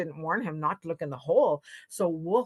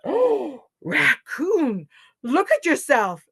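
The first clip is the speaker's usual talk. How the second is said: high, gasped dismay; shocked dismay; 7 semitones higher, 6 dB louder, slower than usual. A narrator's reading. Animals see Raccoon return injured, shocked. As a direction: exclaim loudly at a sorry sight.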